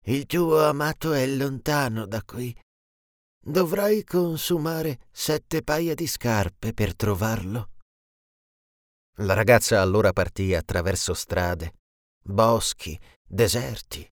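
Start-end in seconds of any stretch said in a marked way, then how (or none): 0.1-7.7 lightly voiced old sorceress, slow and higher; a hint of a wise old witch, slowing on the warning. A narrator's reading. Old sorceress warns the girl, lightly voiced and slow. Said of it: "una vecchia maga che le disse".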